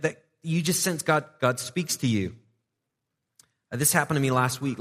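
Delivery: ordinary talk, nothing marked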